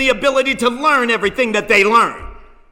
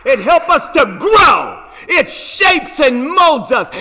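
slow, forceful preaching shout; 4 semitones higher, 3 dB louder, slower than usual. Acted.

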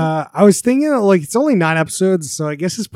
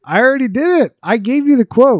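higher-pitched, insistent refusal; exasperated protest; 7 semitones higher, 5 dB louder than usual. Real, not acted. Host to co-hosts pleading for a quote, exasperated protest. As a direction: dig in against the begging. Refusing friends' pleas for an accent.

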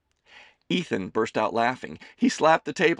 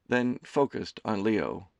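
ordinary talk, nothing marked

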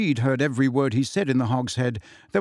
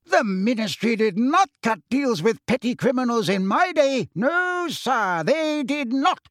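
put-on blustering old portrait; 11 semitones higher than usual. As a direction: bluster like an outraged old man.

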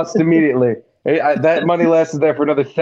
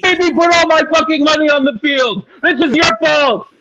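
retold scream; 11 semitones higher than usual. Gambler screaming at the dealer, mock yell. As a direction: blame the dealer at full pitch. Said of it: "I screamed at the Chinese dealer".

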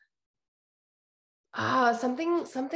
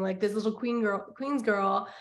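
ordinary talk

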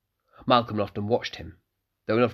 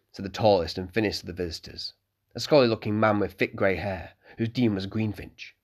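plain reading voice

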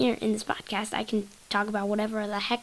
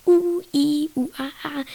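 sour-face noises; 5 semitones higher, 5 dB louder than usual. Shown as a demonstration. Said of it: "the sour part is".

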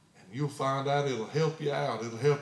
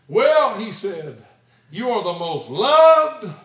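dramatic reveal; 10 semitones higher, 14 dB louder, slower than usual. Retold or imitated. Warden delivers surprising news, dramatic.

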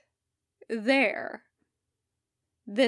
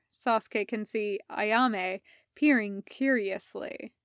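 usual speaking voice

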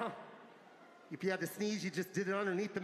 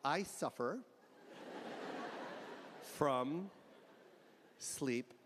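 slow, hesitant excuse, quieter; faked ailment; 9 semitones lower, 5 dB quieter, slower than usual. A maskless man to a security guard, slow hesitant faked excuse. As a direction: drag it out like inventing a lie. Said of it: "I go".

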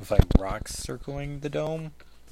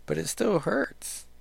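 shaky hurt; 7 semitones higher, slower than usual. Acted. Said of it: "the shakiness of it".

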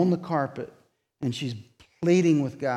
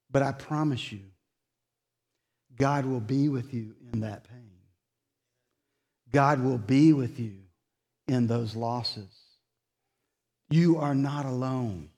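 tender sermon close; 2 semitones lower than usual. Acted. Preacher comforting a grieving congregation, gently. Closing pastoral reassurance.

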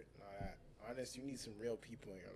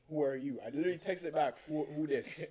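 louder, sharper retold text reply; indignant retort; 3 semitones higher, 8 dB louder than usual. Clerk answering a customer's vague text, loud and indignant. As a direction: snap back at the stranger who texted.

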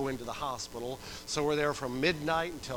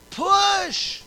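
high, loud drawn shout; cheerleader yell; 14 dB louder than usual. Retold or imitated. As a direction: imitate a doctor cheering her on at full volume. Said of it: "like a cheerleader".

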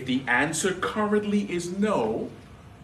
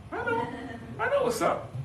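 high teasing. Real, not acted. Teasing the runners.

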